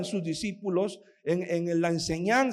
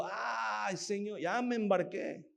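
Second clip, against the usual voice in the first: softly voiced lament; 7 dB quieter, slower than usual. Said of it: "Comenzó a lamentarse".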